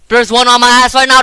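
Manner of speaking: high, loud panicked cry; being chased. Real, not acted. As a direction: yell it while fleeing. Monster chasing him.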